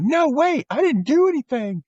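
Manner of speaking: put-on high whiny kid voice; Jeffrey pleading with the principal, high and whiny; whiny protest.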